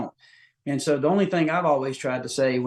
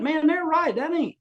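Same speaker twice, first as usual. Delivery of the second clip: sudden realization; 13 semitones higher than usual. Retold or imitated. Realizing the buddies were right.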